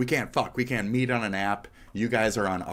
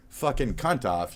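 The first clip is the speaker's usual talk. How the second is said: usual speaking voice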